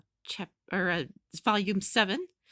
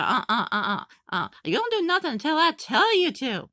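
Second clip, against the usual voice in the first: stern scolding; 6 dB louder than usual. Retold or imitated. Salem scolding Cinder.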